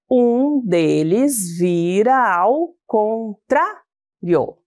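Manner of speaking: "Um deles vira ao contrário" slowly, with the vowels run together and the count stopping at "tra"; slow count of poetic syllables, with "vira ao" merged. Shown as a demonstration. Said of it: "na contagem poética, fica assim". (contagem poética lenta, juntando as vogais e parando no "tra")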